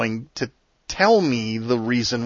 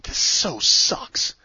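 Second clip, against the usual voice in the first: muttered complaint; 4 semitones higher, much slower than usual. Retold or imitated. Grumbling to himself, fed up.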